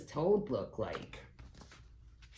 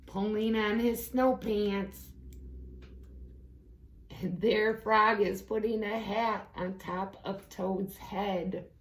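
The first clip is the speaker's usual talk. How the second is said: ordinary delivery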